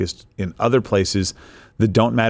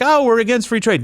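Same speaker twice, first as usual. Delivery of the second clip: mocking quote; 12 semitones higher than usual. Mocking what Americans assume.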